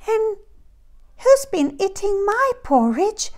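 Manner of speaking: put-on very high baby bear squeak; squeaky little bear. A narrator's reading. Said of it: "squeaked the very small bear".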